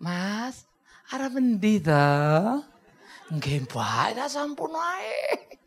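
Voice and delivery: soft, drawn-out Solo Javanese lilt; mock-genteel slow Javanese speech. Shown as a demonstration. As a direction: gently stretch it out like a polite Solo local. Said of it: "kalau kau pergi ke Solo, kan lain lagi".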